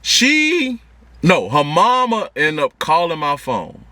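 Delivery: ordinary talk, nothing marked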